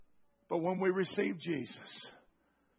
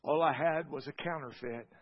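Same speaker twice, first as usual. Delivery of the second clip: prayer. Acted. Closing prayer.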